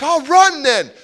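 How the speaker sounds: put-on defiant kid.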